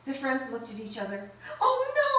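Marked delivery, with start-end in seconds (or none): 1.6-2.1 frightened. Acted. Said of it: "they all whispered".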